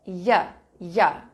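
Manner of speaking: a clear "ya, ya" glide sound. Shown as a demonstration. Teacher showing students the "ya" sound. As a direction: say "ya" like the start of Europe. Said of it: "começou com som de ya".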